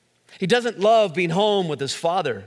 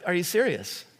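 ordinary delivery

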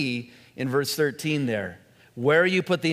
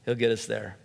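normal talking voice

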